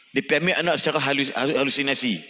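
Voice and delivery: usual speaking voice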